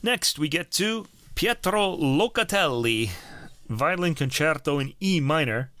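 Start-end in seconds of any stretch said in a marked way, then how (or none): 1.3-3.1 "Pietro" with a trilled Italian R; rolled r. Real, not acted. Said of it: "Ooh, I rolled that R".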